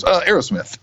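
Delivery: high, hesitant mumble; imitating a clueless answer; high blank answer. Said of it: "he finally goes".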